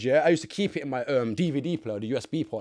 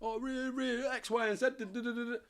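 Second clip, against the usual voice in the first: high-pitched mocking blabber; squeaky sing-along tattling, put on; mock informant; 13 semitones higher, 6 dB quieter than usual. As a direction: imitate someone spilling everything in a high voice. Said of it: "Most man would speak".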